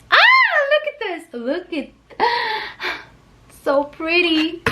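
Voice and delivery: high excited squeal with gasps; real overexcited, high-pitched gushing; too excited. Real, not acted. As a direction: squeal and gasp with uncontainable excitement. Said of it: "I'm just too excited".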